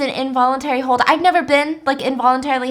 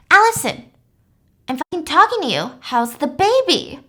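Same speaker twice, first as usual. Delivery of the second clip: hammed-up demanding patient; mock yell at roommate; 5 semitones higher than usual. Patient yelling at her.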